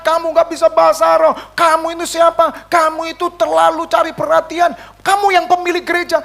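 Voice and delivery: high, hammering mock scolding; acting out an indignant accuser; retold accusation. Retold accusations thrown at him.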